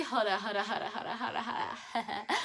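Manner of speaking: laughing through repeated hold up; giggling as she stops herself. Real, not acted. Vlogger stops her story, laughing.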